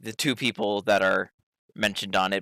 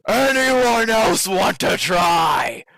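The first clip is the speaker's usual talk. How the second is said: slow, high, loud challenge; shouting; 8 semitones higher, 5 dB louder, much slower than usual. Acted. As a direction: bellow it out to the crowd. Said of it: "Marlo just shouts out".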